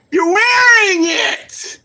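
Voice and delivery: high, loud, slow shout; incredulous shout. Real, not acted. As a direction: yell it in disbelief. Repeats building to shout.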